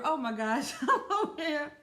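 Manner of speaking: loud, high laughing embarrassment; real laughing. Real, not acted. Host catching a slip in the show title, real laughing. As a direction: laugh out loud through the words.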